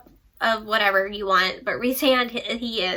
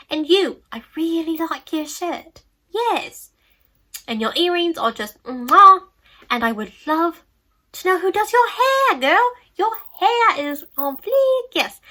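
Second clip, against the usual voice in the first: higher, gushing mock English compliments; sassy English accent; 6 semitones higher than usual. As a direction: fawn over her hair in a camp English accent. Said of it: "talked with an English accent".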